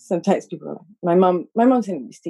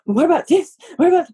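eager questions; 10 semitones higher, 7 dB louder than usual. Retold or imitated. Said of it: "I was like".

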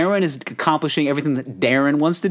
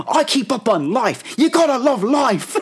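mock Australian trainer, high and upbeat; 12 semitones higher, slower than usual. Trainer telling Darren his secret, mock Australian. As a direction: gush about loving life like a cheesy Aussie coach.

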